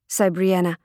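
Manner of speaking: ordinary delivery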